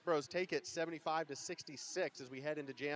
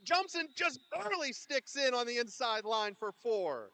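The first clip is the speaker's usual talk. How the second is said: very high, loud play-by-play shout; so excited; 13 semitones higher, 8 dB louder than usual. Real, not acted. Announcer calls another score for Honcho, so excited. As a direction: yell the score, easing down toward the end. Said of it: "He's so excited".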